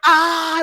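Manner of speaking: high, loud panicked scream; mock drowning scream. Party pounded by waves, high loud mock scream. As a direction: cry out as a wave hits.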